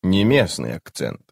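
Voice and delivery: ordinary delivery, nothing marked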